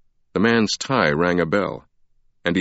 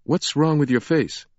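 blunt, raised demand at a stranger; demanding Sienna; 8 semitones higher than usual. A narrator's reading. Sienna demands bluntly of the stranger, raised pitch. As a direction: ask it straight out, a little higher. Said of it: "Sienna demanded".

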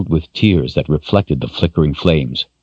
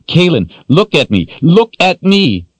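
frantic shout; 12 semitones higher, 4 dB louder than usual. Acted. Richard frantically calls to her.

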